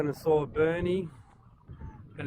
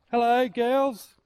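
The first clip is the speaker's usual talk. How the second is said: calling chickens; 7 semitones higher, slower than usual. Real, not acted. Greeting hens in the yard.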